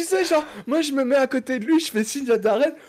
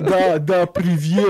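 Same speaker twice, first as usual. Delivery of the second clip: clumsy put-on Russian accent; 3 semitones lower, 5 dB louder, slower than usual. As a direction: read it out in a hammy Russian accent. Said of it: "j'essaie d'imiter l'accent".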